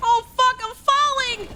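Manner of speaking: high panicked yelp; losing balance. Real, not acted. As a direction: cry out while falling. Falling in game.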